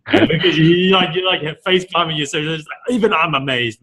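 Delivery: excited. Real, not acted. Host amazed at guest's bombs.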